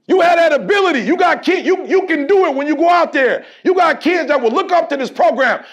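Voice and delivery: loud, hammering repetition of gotta; preaching at full force. Acted. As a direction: drive it home to the players. Exhorting the team.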